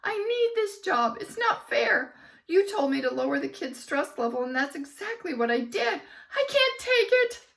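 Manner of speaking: very high, loud frantic wail; distraught. A narrator's reading. Teacher wailing she can't take it. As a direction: plead on the edge of tears.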